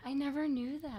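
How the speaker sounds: soft, distant aside away from the mic. Real, not acted. Guest reacting to host's confession, off-mic. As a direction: say it softly, away from the microphone. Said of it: "Wait, hold your mic".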